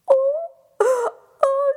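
high sobbing wail; fairy crying. Acted.

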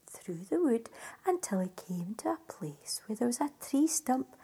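plain reading voice

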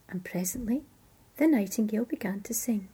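ordinary delivery

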